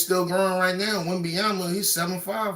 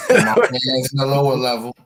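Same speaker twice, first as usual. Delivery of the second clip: laughing; 4 semitones lower, 6 dB louder than usual. Real, not acted. Laughing at own boast.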